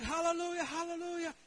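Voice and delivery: high, loud hallelujahs; excited praise. Retold or imitated. Pastors getting blessed, high excited praise. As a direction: bubble over with joy.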